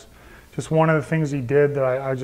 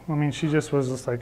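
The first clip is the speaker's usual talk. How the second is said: usual speaking voice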